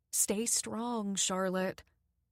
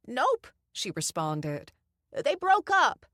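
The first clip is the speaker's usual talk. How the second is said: put-on very high, loud old-lady call; wife calls the gossip to her husband; calling back; 6 semitones higher, 7 dB louder, slower than usual. A narrator's reading. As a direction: shout the news across the house.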